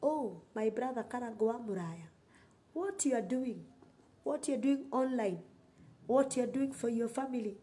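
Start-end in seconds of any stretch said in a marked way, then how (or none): none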